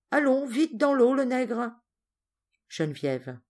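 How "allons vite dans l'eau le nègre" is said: higher-pitched, impatient command; bossy order. Acted. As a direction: order him about like a spoilt child. Boy ordering servant into water.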